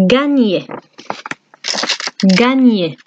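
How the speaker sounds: gagner said the French way, gan-yay. Shown as a demonstration. Teacher modelling gagner for the class. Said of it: "we say".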